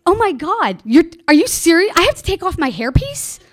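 high, loud, stammering incredulity; aghast disbelief. Retold or imitated. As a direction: question it in scandalized disbelief. Aghast at misheard order.

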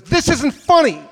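loud, slow scolding cop voice; stern trooper. Retold or imitated. Trooper scolding the giggling driver, loud stern rebuke.

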